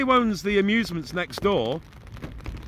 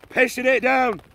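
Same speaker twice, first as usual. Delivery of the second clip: mock Scottish accent; 6 semitones higher, 4 dB louder than usual. Repeating the line in accent.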